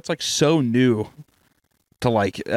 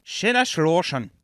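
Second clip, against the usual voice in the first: higher, hammed-up German pronunciation; mock German accent; 6 semitones higher than usual. Friend echoing the company name, mock German accent. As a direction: echo it in a pretend German accent. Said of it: "Was that a good German accent?".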